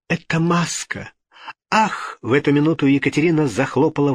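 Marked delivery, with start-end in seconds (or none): 0.1-2.2 high-pitched shocked exclamation; horrified cry. A narrator's reading. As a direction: cry out as the truth hits. Horrified girl learns the truth.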